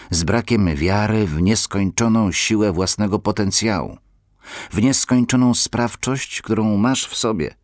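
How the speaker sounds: impassioned sermon voice; preaching. Acted. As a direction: preach it with growing force. Preaching to listeners.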